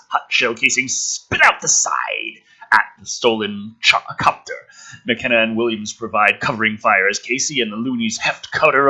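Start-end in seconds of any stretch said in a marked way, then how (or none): none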